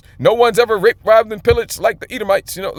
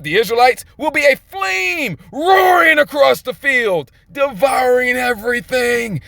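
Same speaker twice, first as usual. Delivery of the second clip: high, slow proclaimed verses; fiery preaching; 4 semitones higher, slower than usual. Acted. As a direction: roar the verse out like judgment. Reads scripture at full force.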